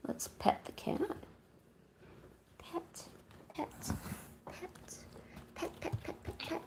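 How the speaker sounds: soft rhythmic whisper; hushed repeated pet pet. Real, not acted. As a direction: whisper gently as you stroke the cat. Whispering while petting cat.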